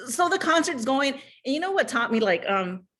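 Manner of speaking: ordinary talk, nothing marked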